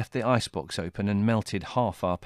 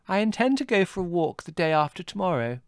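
put-on high, light breezy girl's voice; airily; 10 semitones higher than usual. A narrator's reading. Margot luring Adrian into a walk, airy. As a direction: toss it off casually as bait. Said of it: "said Margot airily".

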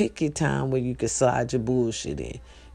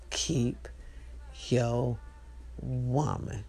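each word set apart with pauses; softer, with long gaps between words; spaced out and hushed; slow drawn-out; 4 semitones lower, 7 dB quieter, much slower than usual. Shown as a demonstration. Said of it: "drawn-out advice".

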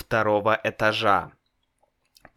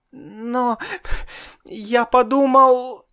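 frightened; 13 semitones higher, 3 dB louder, slower than usual. A narrator's reading. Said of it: "испуганно сказал Славик".

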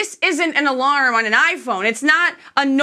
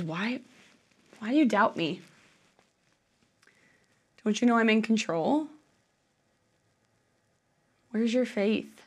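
gentle Jesus; 7 dB quieter than usual. Acted.

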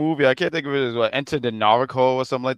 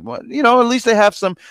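mock upbeat quote; 10 semitones higher, 4 dB louder than usual. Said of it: "but I was like".

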